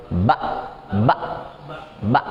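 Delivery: "Ba" cut off by a slightly pressed hamzah; pressed glottal stop. Shown as a demonstration. Teacher answering how silent hamzah is read, slightly pressed stop. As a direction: stop "Ba" short in the throat, no ain. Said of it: "dia kayak ditekan sedikit".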